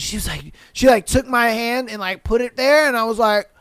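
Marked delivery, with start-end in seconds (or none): none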